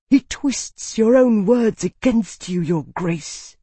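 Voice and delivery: high, slow, venomous accusation; hissed accusation. A narrator's reading. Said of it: "Calyx hissed".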